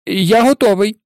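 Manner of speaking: bracing for a fight; stubborn defiance. A narrator's reading. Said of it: "затявся Невіл".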